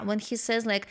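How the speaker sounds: ordinary talk, nothing marked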